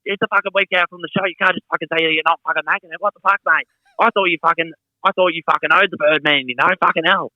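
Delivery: high, fast raging promoter; mock furious rant. Promoter rants at wrestler who cancelled, high fast fury. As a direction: rattle off the outrage at breakneck speed, voice way up. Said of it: "he went fucking ballistic".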